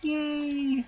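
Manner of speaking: very high, delighted squeal; excited yay. Real, not acted. Player finding stone by the river, excited squeal.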